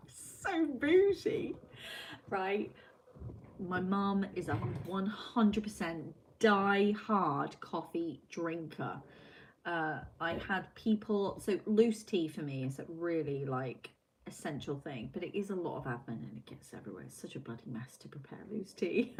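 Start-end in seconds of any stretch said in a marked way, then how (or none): 0.1-2.7 mock posh. Mocking own posh habit. 17.0-19.2 whispered grumble. Real, not acted. Whispered grumble about loose tea.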